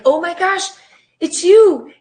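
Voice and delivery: high and loud, overwhelmed; amazed cry. Retold or imitated. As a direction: burst out in awe at seeing him. Retold awe at meeting Jesus.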